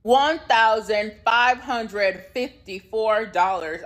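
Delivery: drawn out slowly, each number hit; slow emphatic sum. Real, not acted. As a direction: spell out the outrageous bill.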